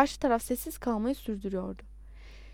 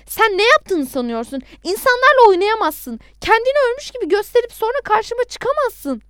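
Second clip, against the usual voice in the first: loud, high, furious rebuke; angry shout; 11 semitones higher, 10 dB louder than usual. A narrator's reading. Adnan scolding the man who faked death, shouting. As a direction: build to a high, outraged yell. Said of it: "diye bağırmaya başladım".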